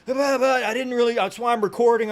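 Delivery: high, loud mock stammering protest; flustered excuse. Evicted man explaining himself to officer, high and flustered.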